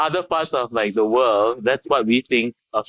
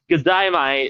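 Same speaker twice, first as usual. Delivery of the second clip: a higher, put-on Aussie greeting; Australian accent; 4 semitones higher, slower than usual. American guest playfully greeting Sydney fans, Australian accent. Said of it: "more Australian expressions other than "G'day, mate".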